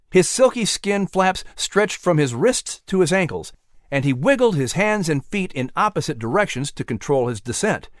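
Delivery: ordinary delivery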